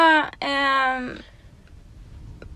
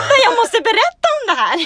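laughing; 10 semitones higher, 5 dB louder than usual. Real, not acted. Laughing while retelling the hookup.

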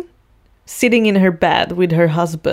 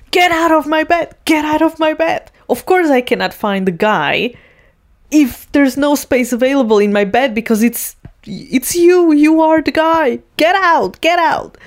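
mock indignant; 8 semitones higher than usual. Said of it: "And she's like".

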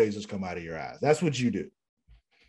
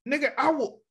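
menacing threat. Retold or imitated. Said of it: "I'm like".

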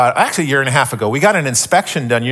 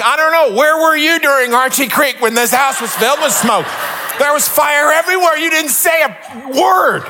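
indignant shout at the alarm; 11 semitones higher than usual. Retold or imitated. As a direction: tell off the alarm for its silence. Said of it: "I'm like".

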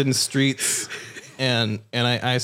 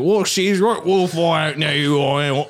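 high, panicky mock Cockney; Benedict Cumberbatch; 3 semitones higher, 3 dB louder, slower than usual. Getting scared too, hammed-up Cockney panic. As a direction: garble it in frantic fake Cockney. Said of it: "I'm getting scared too".

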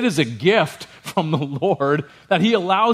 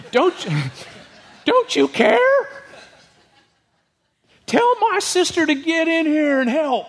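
high, laughing mock whine, slowed on care; whiny Martha; 13 semitones higher than usual. Martha demanding Jesus send her sister, high nagging whine. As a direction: nag like a frazzled hostess, pitched way up. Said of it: "I don't know if that's what she sounded like".

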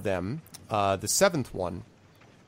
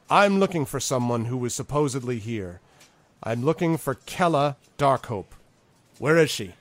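lightly voiced seeker, raised and louder; a touch of urgent demand; insistent rider; 4 semitones higher, 5 dB louder than usual. Elf rider demands to find Kella, mildly insistent.

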